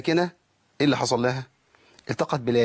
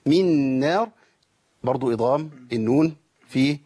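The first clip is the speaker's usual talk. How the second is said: usual speaking voice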